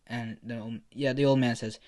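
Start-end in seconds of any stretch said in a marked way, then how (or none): none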